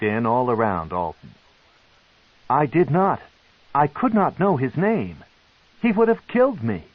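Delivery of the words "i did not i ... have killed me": high, loud panicked denial; stuttering denial. A narrator's reading. Alton denies the killing to the matron, frightened. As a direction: plead it, scared and fast. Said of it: "Alton stuttered".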